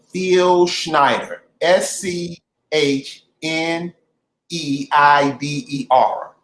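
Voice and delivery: slow, spaced-out letter by letter; slow spelling-out. Real, not acted. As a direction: spell the name out slowly and deliberately. Spells out a name for listeners.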